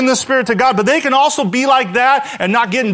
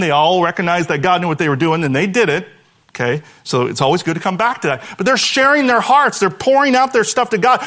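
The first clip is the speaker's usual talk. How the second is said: usual speaking voice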